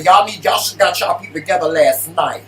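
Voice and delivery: loud, quick mock scolding; playful telling-off. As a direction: jump on a friend, just playing. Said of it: "I'm just playing".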